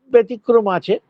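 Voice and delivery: ordinary talk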